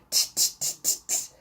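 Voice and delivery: series of soft, toneless tuts; tongue-clucking tuts. A narrator's reading. Miss Sparrow fretting to herself over missing Sparrow, soft tutting. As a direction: tut to yourself under your breath. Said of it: "said Miss Sparrow, talking to herself".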